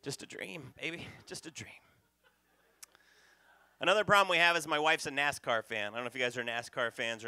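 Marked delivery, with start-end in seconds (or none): 0.0-1.7 soothing hush. Acted. Soothing the wife hastily.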